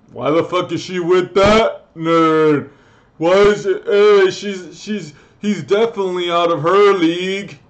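loud, high, indignant imitation; jealous mock outburst. Friend sees her boyfriend and calls him out of her league, mock shouting. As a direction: shout it like an outraged hater.

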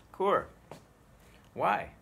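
acted question. Shown as a demonstration.

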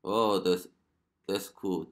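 ordinary talk

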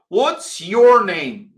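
said slowly, every word clear; slow teacher demo; slow model phrase. Shown as a demonstration. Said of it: "teacher models slowly".

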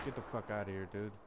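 normal talking voice

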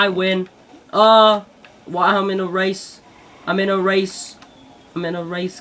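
normal talking voice

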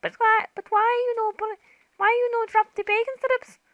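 put-on very high, childish whine; squeaky little voice begging the pig for bacon; whiny high voice. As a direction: plead like a spoilt child.

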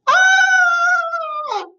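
very high, long squeal; excited squeal. Real, not acted. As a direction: let out a piercing "ah" of delight. Squeals after blowing houses over.

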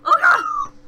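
high, loud scream of fright; panicked scream. Real, not acted. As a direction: shriek as the pod breaks. Monster breaks into the pod.

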